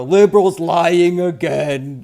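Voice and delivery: loud, slow, sneering mock jeer; mocking critic voice. Streamer imitating critics who'd catch a wrong count, mocking jeer. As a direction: parody the gloating critics. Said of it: "then they're gonna go".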